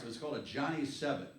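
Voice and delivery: ordinary delivery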